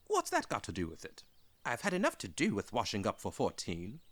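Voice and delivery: indignant little protest; Bilbo fluster. Acted.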